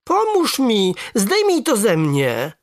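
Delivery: high, drawn-out wail for help; pleading call. A narrator's reading. Boy begs grandfather to untie the stone, wailing. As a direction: plead loudly, stretching the words. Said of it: "zawołałem".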